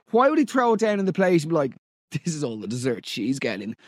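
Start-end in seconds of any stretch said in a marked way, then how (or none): 2.1-3.8 mock quasi-American accent, much lower and softer. Host mocking the date's line in a quasi-American accent. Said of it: "in that sort of quasi-American-esque accent".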